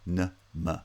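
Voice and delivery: N-ma as two separate weak schwa syllables; reduced vowels. Shown as a demonstration. Teacher isolating unstressed syllables, N-ma with reduced vowels. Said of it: "because they're unstressed syllables".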